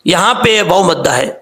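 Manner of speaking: ordinary talk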